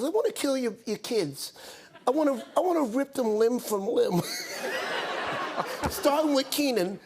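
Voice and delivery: a hammed-up Tyson, building to a yell; Mike Tyson impression. Tyson threatening Mrs Wayans about her sons, rising to a shout. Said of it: "he goes".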